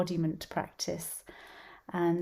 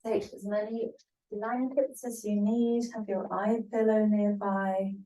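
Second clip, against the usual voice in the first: unhurried instruction with long pauses between phrases; spaced-out relaxation teacher's voice; guided yoga nidra; 4 dB louder than usual. Acted. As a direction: guide a class gently into rest, phrase by phrase.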